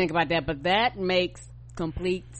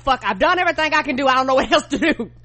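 louder, fast frustrated outburst; mock frustrated; 5 semitones higher, 6 dB louder, faster than usual. Partner retelling her own tech frustration, louder and fast. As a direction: vent your fed-up frustration. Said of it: "'cause I'm like".

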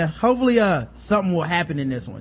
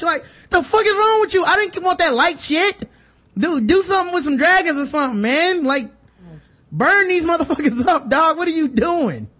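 high-pitched comic outrage; worked-up, incredulous tirade; exasperated rant; 9 semitones higher than usual. Acted. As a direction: berate a useless wizard, voice pitched up. Exasperated rant at Gandalf's useless powers.

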